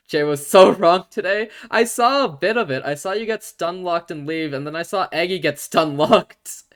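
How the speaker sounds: loud, high, laughing delight; gleeful, can't stop laughing. Real, not acted. Laughing at friend's failure.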